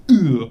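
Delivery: loud split-second grunt; one abrupt, loud "ugh" as a whole impression. Comic does a split-second rapper impression, loud grunt. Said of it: "Split second impression of E forty".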